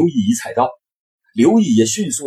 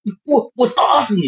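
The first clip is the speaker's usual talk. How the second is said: stammering rage; 12 semitones higher than usual. Acted. Furious threat after insult.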